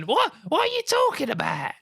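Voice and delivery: high-pitched, put-on Cockney woman; hammed-up squawk, playing at a Victorian Cockney; mock Victorian woman. Co-host imitating her friend, mock Victorian woman. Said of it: "God, like a Victorian woman".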